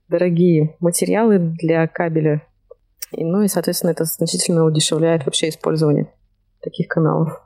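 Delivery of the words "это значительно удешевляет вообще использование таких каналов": ordinary delivery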